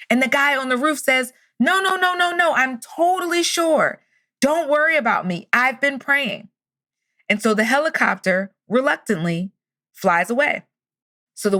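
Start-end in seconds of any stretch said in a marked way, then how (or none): 1.6-6.5 high, stretched-out mock holler; calling back. Man on roof turns down last rescue, calling up. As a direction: imitate a confident refusal shouted upward.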